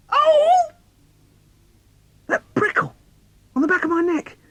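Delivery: very high, loud startled cry; boy startled by a prickle in the dark; startled fright. Acted. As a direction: jump at a touch on the neck. Child feels something on neck.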